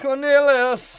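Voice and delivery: high, drawn-out British exclamation; slow, playful British voice; mock British. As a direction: draw the name out in a hammed-up British accent. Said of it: "so I can sound British when I say it".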